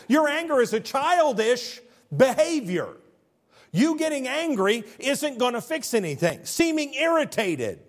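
high, forceful pulpit delivery; emphatic preaching. Real, not acted. Preaches with raised voice.

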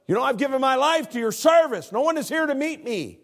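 high, loud complaining prayer; a worn-out missionary crying out to God; self-pitying missionary. Acted. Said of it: "he said".

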